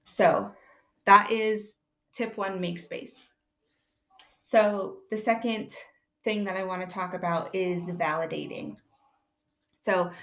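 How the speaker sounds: ordinary talk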